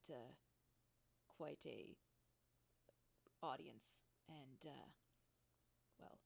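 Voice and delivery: halting, sheepish employee with long pauses; mildly uneasy, trailing into fillers; awkward hesitance. Acted. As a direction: stall and fumble toward an awkward point. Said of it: "he kinda shuffles his feet a little bit".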